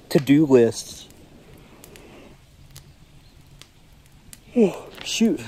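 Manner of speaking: talking through a yawn; words stretched by a big yawn. Real, not acted. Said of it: "Sorry I keep yawning".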